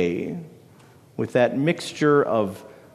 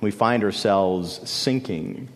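hushed sermon build; 5 semitones lower, 3 dB quieter than usual. Acted. Dramatic sermon imagery.

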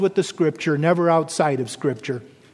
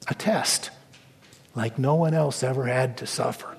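hushed; 6 semitones lower, 6 dB quieter than usual. Real, not acted. Hushed point in the sermon.

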